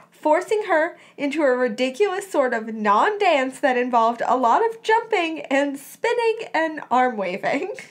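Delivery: ordinary delivery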